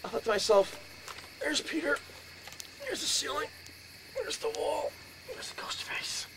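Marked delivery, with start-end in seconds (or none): 1.4-6.3 high at first, fading into a whisper on ghost face; hushed waking whisper. Retold or imitated. Telling the crowd about the night he woke to the ghost, fading into a whisper. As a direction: breathe it out as you spot the ghost.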